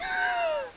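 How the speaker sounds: real high-pitched laughing fit; high squealing laugh. Real, not acted. Streamer cracking up at own Walmart joke, high squealing laugh. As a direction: squeal with laughter, trailing away.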